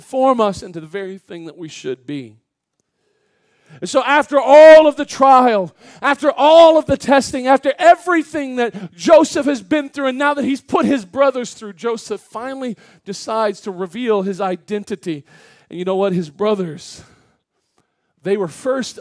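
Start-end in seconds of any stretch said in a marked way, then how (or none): 3.8-15.2 loud, high, driving sermon delivery; impassioned preaching. Real, not acted. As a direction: preach with building force, punching key words. Preaches at full force.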